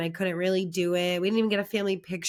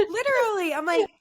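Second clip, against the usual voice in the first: high, loud indignant agreement; mock incredulity; 12 semitones higher, 7 dB louder than usual. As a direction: call out a high, exaggerated reality check.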